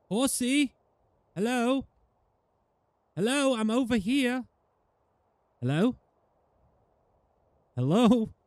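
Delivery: yelling across a valley to a horse; calling out. Real, not acted. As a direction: shout to a horse far away.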